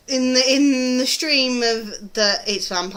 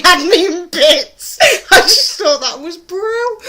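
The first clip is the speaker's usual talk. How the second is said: higher and louder, words through real laughter; laughing; 9 semitones higher, 9 dB louder than usual. Real, not acted. Fan recalling the funny line, laughing as she talks.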